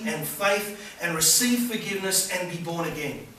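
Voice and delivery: loud, high, impassioned sermon delivery; preaching at full force. Acted. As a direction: preach it out to the whole hall with fervour. Preaching to the congregation.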